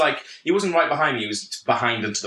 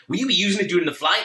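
quick, higher mock passenger; douchebag from LA; 6 semitones higher, faster than usual. Seatmate asking to use the outlet, mock douchebag. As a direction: mimic the annoying seatmate at a quick clip. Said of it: "this absolute douchebag from L A".

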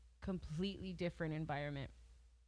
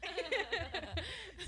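laughing; 9 semitones higher, much slower than usual. Real, not acted. Laughing over racist question.

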